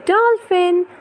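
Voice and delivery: a high, bright announcement of the animal; excited reveal. Acted. Mother showing baby the dolphin page, excited reveal. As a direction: pop the word up high like a surprise.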